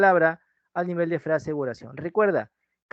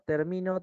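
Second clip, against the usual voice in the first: "termino" as ter-MI-no, softer; stress on middle syllable; 5 dB quieter than usual. Shown as a demonstration. Teacher quizzing students on meaning, "termino" stressed on MI. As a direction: put the weight of "termino" on MI. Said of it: "su desplazamiento distingue el significado".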